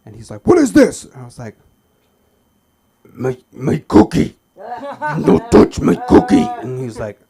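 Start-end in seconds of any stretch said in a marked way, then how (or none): 0.5-1.1 high, loud startled demand; mock waiter outrage. Waiter finding stashed cookies, loud outraged demand. 3.1-6.5 slow, loud, put-on Cookie Monster growl; guard the cookies like Cookie Monster. Boy defending his cookies from the waiter, Cookie Monster voice.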